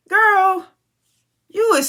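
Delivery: a disbelieving high call at her; exasperated call-out. Real, not acted. Calling out a cast member.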